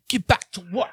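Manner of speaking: high-pitched caricature of a boss; Andrew Tate. Retold or imitated. Imagined boss ordering workers back, high mock voice. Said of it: "and he'd be like".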